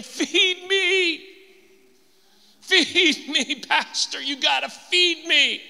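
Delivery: high, nagging "feed me" whine, mocking; whiny churchgoer. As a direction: beg like a spoiled child at the table. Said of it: "they're saying".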